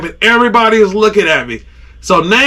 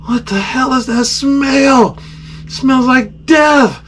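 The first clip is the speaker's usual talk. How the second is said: slow, higher-pitched disbelief; appalled disgust; 4 semitones higher, slower than usual. Retold or imitated. Angler waking to an awful smell, appalled disgust. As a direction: stretch it out in horrified revulsion.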